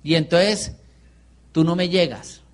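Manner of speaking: ordinary talk, nothing marked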